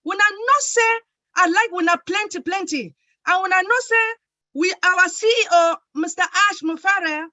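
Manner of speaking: switching into Nigerian Pidgin English. Shown as a demonstration. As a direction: speak it in warm Nigerian Pidgin. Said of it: "time for me to speak in Pidgin".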